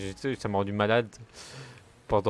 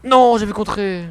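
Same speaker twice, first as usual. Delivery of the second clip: high, loud, slow outraged yell; angry gamer shout; 9 semitones higher, 11 dB louder than usual. Real, not acted. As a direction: protest at the screen at full voice. (cri indigné, fort et aigu)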